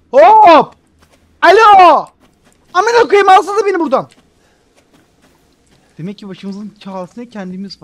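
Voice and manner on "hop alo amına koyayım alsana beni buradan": loud, high shouted calls; calling out loud. Real, not acted. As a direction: shout for someone to get you out. Calling someone to rescue.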